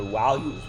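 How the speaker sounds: "values" begun with a W sound. Shown as a demonstration. Teacher joking about a near slip, W for V in "values". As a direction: say "values" as "walues". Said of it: "with a W".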